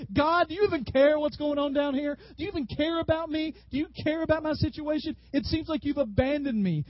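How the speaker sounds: high-pitched, impassioned crying out; desperate plea to God. Acted. Believer in the storm challenging God, anguished plea. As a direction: cry out as one who feels abandoned. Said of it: "the question you want to say to God".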